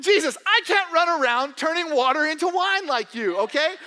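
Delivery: hammed-up protest, arms up; mock exasperated complaint. Pastor playing a fed-up believer talking back to Jesus, mock whine.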